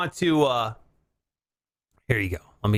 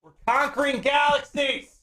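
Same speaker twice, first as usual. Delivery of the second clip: high, exasperated alien yell; hammed-up frustrated outburst; mock scolding; 8 semitones higher than usual. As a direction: scold a blubbering crewmate. Fed-up alien scolds Bleep Blop.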